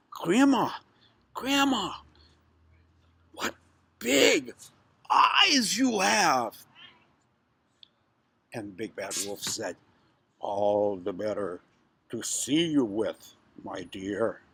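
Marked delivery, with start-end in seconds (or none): 0.1-6.6 put-on high, drawn-out childlike amazement. Girl marvels at wolf's eyes. 10.4-14.4 low, slow, sly wolf; wolf posing as Grandma. Acted. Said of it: "pretending to be Grandma".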